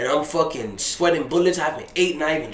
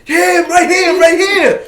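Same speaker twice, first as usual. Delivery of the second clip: calling out; 14 semitones higher, 9 dB louder, much slower than usual. Retold or imitated. Crowd pointing him out.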